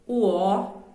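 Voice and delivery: normal talking voice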